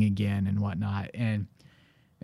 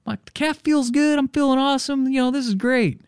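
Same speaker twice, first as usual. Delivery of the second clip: far higher, buoyant and thrilled; giddy texting. Retold or imitated. Runner texting his wife mid-race, giddy and thrilled. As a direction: gush like a runner on a high.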